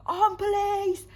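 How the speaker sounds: put-on high, slow, shouted London accent police call; London cop accent. Vlogger quoting Line of Duty after holding a gun, shouting in a London accent. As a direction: bark it like a London copper making an arrest. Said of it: "you know, that London accent".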